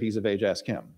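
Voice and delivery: low, soft "He's of age, ask him" dodging blame; evasive parents. Acted. Parents dodging trouble before the Pharisees, quiet and evasive. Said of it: "that particular tone of voice with their words".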